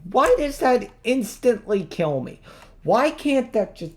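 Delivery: high, loud furious rant. Real, not acted. Gamer raging at an instant death, high loud fury. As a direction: rage at the screen, voice climbing high.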